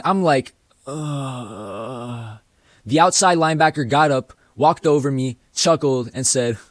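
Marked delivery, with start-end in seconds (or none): 0.8-2.4 soft pained groan. Retold or imitated. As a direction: groan like you're on the ground hurt. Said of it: "I'm groaning".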